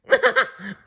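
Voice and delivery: very high laughing bird call; kookaburra cackle. A narrator's reading. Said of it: "offered Jacko".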